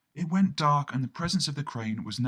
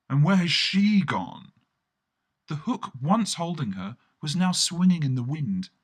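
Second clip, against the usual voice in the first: plain reading voice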